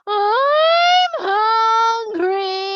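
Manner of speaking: very high, loud whiny moan; complaining. Acted. People complaining of hunger, loud high whine. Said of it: "people start to complain again".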